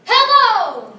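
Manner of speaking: booming perky phone greeting; perky announcer shout. Retold or imitated. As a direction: blast it like a game show host. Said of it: "I'll shout into the receiver".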